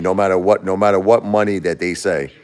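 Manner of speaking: ordinary talk, nothing marked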